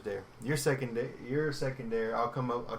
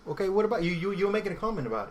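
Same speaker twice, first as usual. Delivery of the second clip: put-on brisk, higher, amateur British accent; British accent on a dare; 7 semitones higher, 3 dB louder, faster than usual. Podcaster asking the co-host for a comment, attempted British accent. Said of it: "I'll speak in a British accent".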